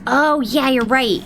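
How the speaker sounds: usual speaking voice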